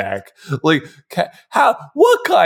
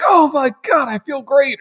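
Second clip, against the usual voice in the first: high-pitched burst of joy; hammed-up squeal of sudden well-being; mock revived elation; 10 semitones higher than usual. Imagined character waking up revived.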